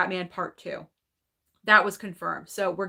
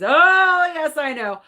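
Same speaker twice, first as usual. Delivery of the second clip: high, loud drawn-out "Oh", then easing; excited agreement; 7 semitones higher, 7 dB louder, slower than usual. Real, not acted. Host replies to fan counting days to Scream six, excited. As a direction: burst out with delighted agreement.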